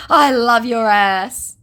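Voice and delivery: mock sleazy scammer. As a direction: leer like him. Voicing scammer.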